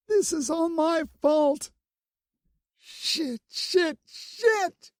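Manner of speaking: high, tearful self-blame; falling apart in panic; distraught. A narrator's reading. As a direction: Bloom crying over his wounds. Bloom crying, blaming herself.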